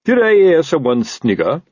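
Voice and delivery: sharp accusing question; demanding. A narrator's reading. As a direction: demand it like an affronted officer. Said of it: "he demanded".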